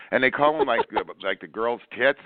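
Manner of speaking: ordinary talk, nothing marked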